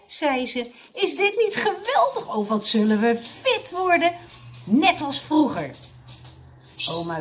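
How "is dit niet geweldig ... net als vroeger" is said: high, louder gushing; delighted. A narrator's reading. Mrs Mosterd thrilled about getting fit, delighted. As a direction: bubble over with enthusiasm. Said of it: "Met een opgetogen glimlach".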